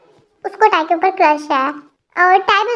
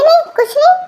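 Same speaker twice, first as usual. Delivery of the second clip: flustered denial; 10 semitones higher, 4 dB louder than usual. Acted. Uncle flustered denying.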